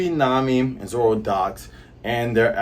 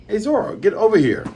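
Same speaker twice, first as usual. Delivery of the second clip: higher-pitched call to someone over there; 8 semitones higher than usual. Acted. Reader voices Nami summoning Zoro, calling out. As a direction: call him over, voice lifted.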